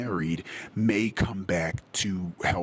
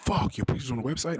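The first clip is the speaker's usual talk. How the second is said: shocked disbelief; 8 semitones higher, faster than usual. Retold or imitated. Retelling his reaction to his friend's news, shocked and high. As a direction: pitch jumps high in a retold gasp of shock.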